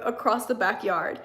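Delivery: ordinary delivery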